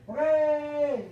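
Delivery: high, loud whoop of joy; excited cheer. Acted. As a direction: cheer like a thrilled dragon. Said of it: "the dragon's going".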